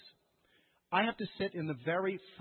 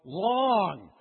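frustrated plea, louder, slow, rising high on listening; exasperated demand to be heard; upset with God; 4 semitones higher, 7 dB louder, slower than usual. Retold or imitated. Believer venting frustration at God over unanswered prayer. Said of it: "I get really upset with God".

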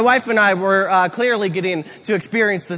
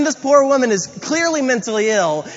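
ordinary talk, nothing marked

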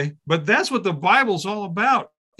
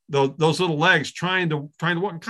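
ordinary talk, nothing marked

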